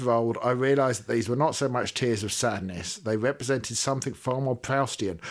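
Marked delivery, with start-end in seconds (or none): none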